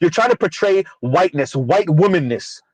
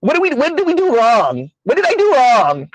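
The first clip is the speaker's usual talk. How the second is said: mock Valley Girl; 6 semitones higher than usual. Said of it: "She tried to run through".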